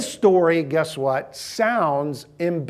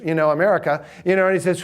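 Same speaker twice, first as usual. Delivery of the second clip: normal talking voice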